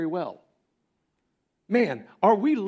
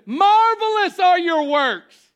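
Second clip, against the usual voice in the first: very high, loud praise; exultant proclamation; 14 semitones higher, 9 dB louder than usual. Shown as a demonstration. Pastor offers another verse to proclaim, exulting. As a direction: cry it out in praise. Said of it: "How about this?".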